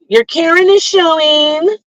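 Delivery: high, singsong mock call-out; mock accusation. Someone calling out her Karen moment, mock accusing. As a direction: mimic a high-pitched busybody calling someone out.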